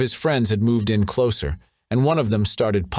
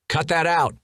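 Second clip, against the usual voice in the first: yelling; 9 semitones higher than usual. A narrator's reading. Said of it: "Nasrin yelled".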